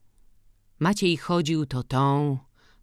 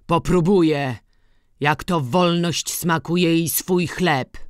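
defiant retort; 4 semitones higher, 7 dB louder than usual. A narrator's reading. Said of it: "odpowiedział stary".

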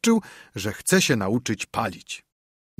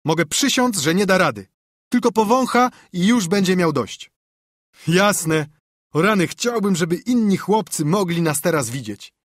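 put-on higher, louder boyish bragging; cocky boy; 7 semitones higher, 4 dB louder than usual. Joe boasts to Tom, higher and louder. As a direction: swear it with swagger.

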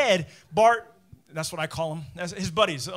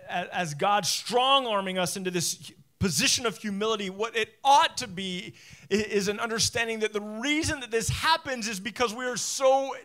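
louder, rising passionate preaching; preaching at full force; 4 semitones higher, 5 dB louder than usual. Acted. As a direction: build it into a fervent sermon.

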